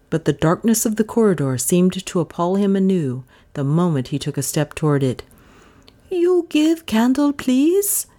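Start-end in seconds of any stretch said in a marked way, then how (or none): 6.1-8.1 high-pitched plea in simple broken English; pleading. A narrator's reading. Butler asks for a candle before the dark corridor, pleading. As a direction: beg timidly in a high voice. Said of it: "he asked with a pleading expression".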